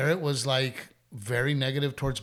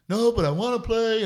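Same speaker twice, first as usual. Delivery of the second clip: put-on higher, louder kid insisting; son protesting; 8 semitones higher, 7 dB louder than usual. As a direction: whine it like the boy. Son's reply voiced by dad.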